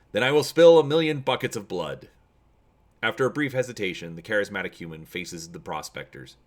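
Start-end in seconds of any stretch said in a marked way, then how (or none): 0.1-2.1 loud threat. Acted. General answers the threat.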